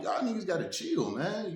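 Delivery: high, firm call to back off; pressing plea to chill. Retold or imitated. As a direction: order them to cool it. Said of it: "I'm like".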